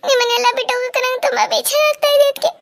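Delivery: high-pitched crying voice, breaking into sobs; tearful sobbing. Acted. Bride telling her family she hates leaving, sobbing. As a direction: cry the words out with sobbing gaps. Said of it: "ಯಾಕೆ ಮಧು ಇಷ್ಟೊಂದು ಅಳ್ತಾ ಇದೀಯಾ".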